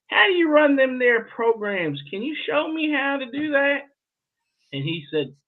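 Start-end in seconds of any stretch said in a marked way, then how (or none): 0.1-3.8 put-on high-pitched country Southern accent. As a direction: ask it as a hammed-up Southerner. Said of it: "That was my Southern accent".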